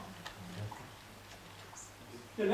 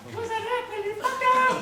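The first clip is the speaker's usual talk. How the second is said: very high, louder mock female voice; feminine voice; 12 semitones higher, 5 dB louder than usual. Magician voicing the audience's suspicious question, mock feminine voice. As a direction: squeal it like an imitated woman, pitched way up. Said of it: "j'essaie de prendre une voix un peu féminine".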